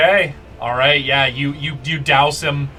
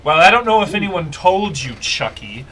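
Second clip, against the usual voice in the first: Akene, bitterly sarcastic; 2 semitones higher, 3 dB louder than usual. Acted. Merchant prince sneers at Chucky the new prince, sarcastic. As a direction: spit mock congratulations at the winner. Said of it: "you guys hear Akene go".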